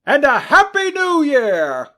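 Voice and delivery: high, bright, ringing call; cheery greeting. Acted. Nephew wishing his uncle a merry Christmas, ringing. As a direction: call it out joyfully.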